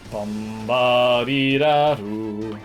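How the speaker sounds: usual speaking voice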